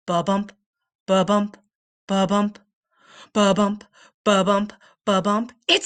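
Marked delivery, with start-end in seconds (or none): none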